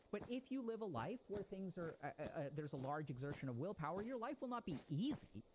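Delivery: ordinary talk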